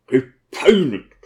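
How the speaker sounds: slow, growled one-word reply; tormented deacon grumbling; grumbled. Acted. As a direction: grumble it out sullenly. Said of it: "brummte Apchilla".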